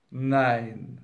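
low, drawn-out, smiling refusal; comfortable, unbothered no; smug refusal. A narrator's reading. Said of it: "erklärte Wolf mit demselben behaglichen Lächeln".

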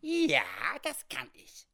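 cheeky, squeaky retort starting very high; unbothered little oddball, pitch swooping; little comic frontiersman. Acted. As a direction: answer smugly in a quirky high voice. Sam Hawkins cheekily answers back.

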